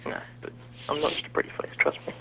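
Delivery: breathy whispered tease; whispering. Real, not acted. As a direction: whisper it with a wink.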